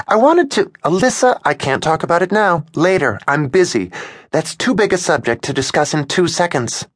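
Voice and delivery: hurried and a little fed up; exasperated. A narrator's reading. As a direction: brush her off, rushed and tense. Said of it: "She was starting to sound exasperated".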